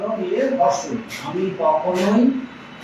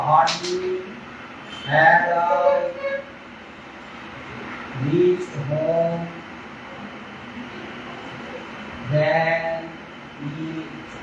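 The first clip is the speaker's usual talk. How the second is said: slow dictation; 3 semitones lower, 6 dB quieter, much slower than usual. Shown as a demonstration. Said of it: "তখন এভাবে লিখেছি".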